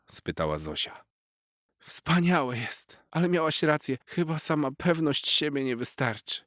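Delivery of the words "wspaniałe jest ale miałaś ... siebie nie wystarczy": humbled cat. A narrator's reading. Narrator voices cat Garfik.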